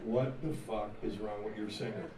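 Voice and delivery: low, hushed retold complaint; soft, low-pitched confidential grumble; confiding aside. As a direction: drop low, as if taking someone aside. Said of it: "he pulled Nikki aside".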